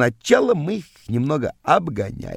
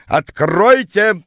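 raised, drawn-out yell; yelling through door; 6 semitones higher, 6 dB louder, much slower than usual. A narrator's reading. Rival yelling at the door to be let in. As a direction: holler it out at a closed door. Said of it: "стал орать он под дверью".

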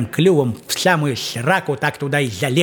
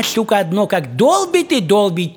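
normal talking voice

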